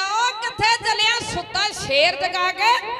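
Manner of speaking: high, loud, slow taunt; calling out. A narrator's reading. Warrior shouting after the fleeing enemy, loud and taunting. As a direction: roar it after the runaway. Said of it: "ਆਵਾਜ਼ ਮਾਰ ਕੇ ਕਹਿਣ ਲੱਗਾ".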